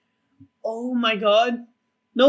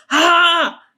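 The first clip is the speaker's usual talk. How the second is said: loud, high startled scream; re-enacted scream; 7 semitones higher, 10 dB louder than usual. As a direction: scream as if jumping at a scare. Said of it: "I screamed 'cause I'm like".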